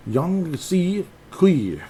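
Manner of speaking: carefully sounding out a tricky name; careful slow name. Pretend. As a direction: sound out the unfamiliar name slowly. Odd name said slowly.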